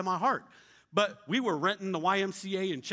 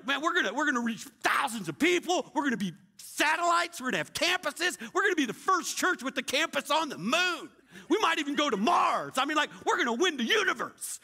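hyped-up pitch; 8 semitones higher than usual. Retold or imitated. Pastor overselling his vision to the rich donor, excited. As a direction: sell the dream big.

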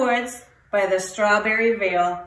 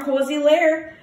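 ordinary delivery, nothing marked

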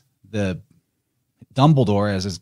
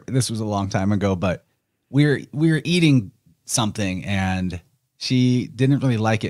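normal talking voice

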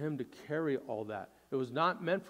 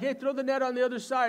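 quick, higher and louder call from the shore; casual Jesus call; 8 semitones higher, 5 dB louder, faster than usual. Retold or imitated. Jesus calling out to the fishermen from shore, quick and upbeat. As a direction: toss out a breezy tip. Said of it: "Jesus is like".

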